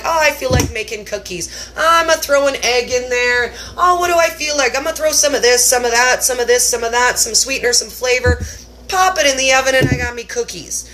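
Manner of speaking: breezy carefree cook. Pretend. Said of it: "You'll be like".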